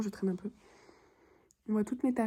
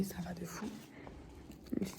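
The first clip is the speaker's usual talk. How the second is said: whispering; 2 semitones lower, 13 dB quieter than usual. Real, not acted. Whispered aside to camera.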